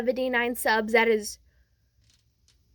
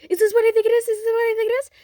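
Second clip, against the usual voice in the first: high, loud, excited questioning; thrilled disbelief; 10 semitones higher, 6 dB louder, much faster than usual. Retold or imitated. Deku jumping around over his gift, thrilled. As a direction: burst out with it, pitched way up.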